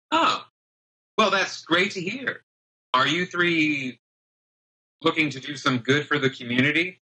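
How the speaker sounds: put-on high, eager, friendly young man. Young man greeting the party with a wink, high and eager. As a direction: lay it on with a sly grin. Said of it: "he looks to Chad the ogre and says".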